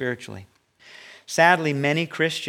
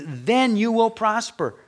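higher, slow, building promise; rising promise; 9 semitones higher, 4 dB louder, slower than usual. Acted. Pastor promising the congregation, rising and slow. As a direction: lift it up like a promise. Climax of the if-then point.

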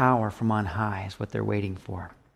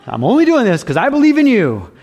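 earnest retold prayer; 8 semitones higher, 6 dB louder, faster than usual. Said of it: "I go".

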